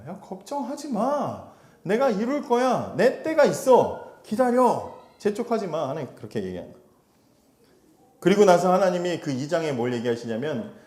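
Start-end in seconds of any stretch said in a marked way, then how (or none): 0.1-5.9 higher, slow, soothing voice of God; calm him down like a patient father; reassuring God. Acted. God reassuring impatient Habakkuk, higher, slow and soothing.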